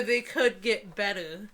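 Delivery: ordinary delivery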